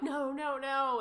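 mild mock scream, high and loud; retold scream. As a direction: re-enact screaming in shock, held back. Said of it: "I started screaming".

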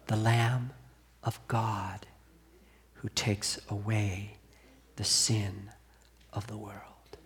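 breathy awed whisper, slowing on each phrase; hushed whisper. Acted. Preacher quoting John to the congregation, in a hushed whisper. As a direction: let the words fall to a reverent whisper.

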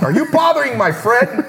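high, hammed-up indignant challenge; over-the-top pretend confrontation; mock threat. Pretend threatening call to investor.

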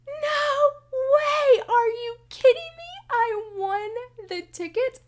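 shrieking high and loud with a crying edge; teenage shriek. Retold or imitated. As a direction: scream like a thrilled teenager on the phone. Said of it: "like a teenager on the phone in octaves I have not heard in years".

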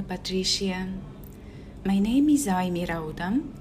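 normal talking voice

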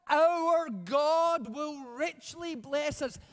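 ordinary talk, nothing marked

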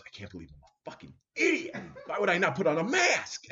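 comic outburst of self-disgust, rising sharply; mock self-berating. Man at home cursing himself over the mask, mock exasperated.

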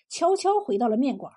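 ordinary delivery, nothing marked

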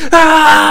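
very high, loud scream; imitated scream. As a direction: shriek in mock agony. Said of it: "he's screaming".

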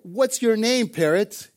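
high-pitched, mock-irritated demand; acted thief's annoyed question; angry. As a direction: confront the parrot indignantly. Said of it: "He's angry, and he says".

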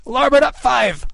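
slow, high, drawn-out command; Kidd crying orders. A narrator's reading. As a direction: cry the order out long across the deck. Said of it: "Kidd cried".

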